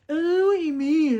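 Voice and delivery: high, loud drawn-out whine; losing husband imitation. Husband losing the history argument, mocking imitation. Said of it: "You always do that when you're losing".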